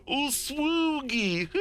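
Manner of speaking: high mocking laughter; hammed-up jeering laugh; bursting out laughing. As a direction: repeat it slowly through a high cackle. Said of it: "they just bust out laughing".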